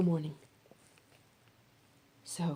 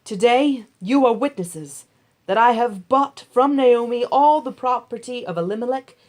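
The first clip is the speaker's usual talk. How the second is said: public announcement; 4 semitones higher, 8 dB louder than usual. A narrator's reading. Boaz announcing to elders and people, loud and solemn. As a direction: proclaim it so the whole gate hears. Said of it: "Boaz announced to the elders and all the people".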